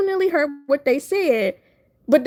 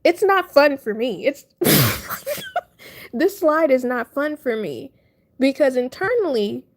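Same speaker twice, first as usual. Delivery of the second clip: ordinary talk, nothing marked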